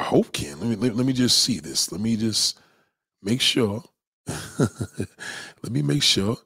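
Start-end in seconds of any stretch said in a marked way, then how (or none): 4.3-6.4 very slow, laughing drawl; drawn out. Shown as a demonstration. Host checks the stream audio, slow laughing repeat. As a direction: stretch every word out with a chuckle.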